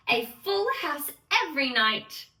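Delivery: usual speaking voice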